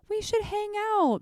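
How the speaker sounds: fake friendly brush-off. Quoting the small talk people throw out, bright and high. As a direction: toss it out as an empty nicety. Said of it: "things of, like".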